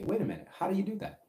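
high, quick, curious "how do you do that"; curious question. Retold or imitated. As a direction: ask it like you really want the trick. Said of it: "we need to say".

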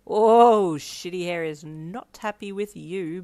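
loud drawn-out oh, then slow gloat; sniggering. A narrator's reading. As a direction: tease with mean relish. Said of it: "Bakugo sniggered at Kaminari".

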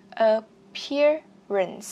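"Appearance" drawn out slowly, syllable by syllable. Shown as a demonstration. Teacher to class: slow, drawn-out "Appearance". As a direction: stretch every syllable of "Appearance". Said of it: "C E các bạn phát âm là chữ S".

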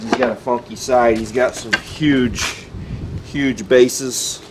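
ordinary delivery

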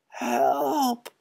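put-on a lost boy crying out; sad wail. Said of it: "wailed his sad voice".

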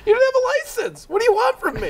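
high, loud indignant squeal; mock outraged yell. Friend defends his drunk drive with a high outraged yell. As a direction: shriek it back at your accuser, all mock outrage.

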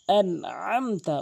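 slow আনআমতা with mid-throat ain; strong ain in আনআমতা; correct ain. Shown as a demonstration. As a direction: squeeze the second আ of আনআমতা from the throat. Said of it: "আইনটি হলকের মাঝখান থেকে".

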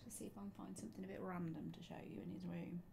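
whispering. Real, not acted. Sneaking around his room.

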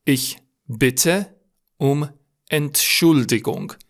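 very slow, word by word with pauses; slow dictation. Shown as a demonstration. Teacher dictating a formal apology, slow and separated. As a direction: separate every word for learners. Teacher repeats phrase slowly for learners.

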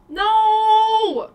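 high, loud cry of dismay; frustrated shout. Real, not acted. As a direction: yell no in frustration. Mic failing, frustrated outburst.

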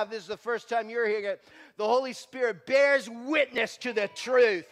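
loud, high, rising preacher's cry; full-force preaching. Acted.

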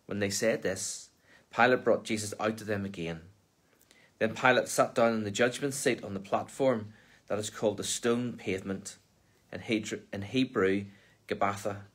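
ordinary delivery

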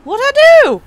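loud, very high mock-innocent cry; squealed protest. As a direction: squeal it in playful indignation. Protesting after being blamed.

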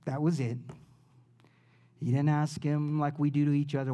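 ordinary talk, nothing marked

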